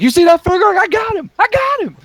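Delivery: very high, squealing donkey voice; loud, giddy talking-animal sidekick; excited donkey. Acted. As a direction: boast in a high cartoon squeal. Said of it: "And then he's like".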